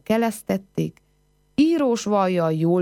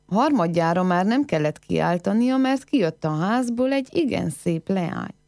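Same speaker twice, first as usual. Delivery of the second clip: usual speaking voice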